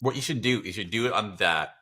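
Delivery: ordinary delivery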